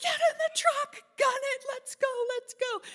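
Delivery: high, loud urgent commands; urgent orders. Retold or imitated. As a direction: hurry everyone into the truck. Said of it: "he's like".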